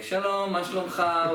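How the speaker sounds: put-on high, bright Israeli-accented Hebrew greeting. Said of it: "with, like, an Israeli accent".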